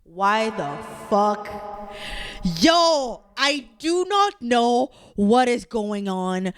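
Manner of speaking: slow, drawn-out disbelief; real outrage. Real, not acted. Quiz taker protesting her result, outraged and emphatic. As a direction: spell out your outrage word by word.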